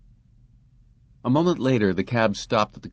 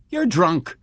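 wife's scornful jab, pitched high and louder; 10 semitones higher, 5 dB louder than usual. Acted. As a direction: snap it at him with contempt. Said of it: "Her lips writhed scornfully".

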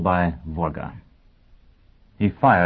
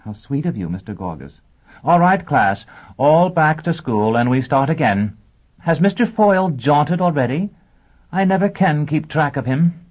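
bright, fluttery lady teacher, much higher; high schoolteacher voice; 7 semitones higher, 4 dB louder than usual. Acted. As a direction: play the scatterbrained teacher addressing her class. Teacher Robin voiced in story.

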